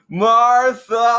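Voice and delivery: high, loud anguished yell; screamed Martha. Retold or imitated. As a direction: scream the name out. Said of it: "Superman screamed".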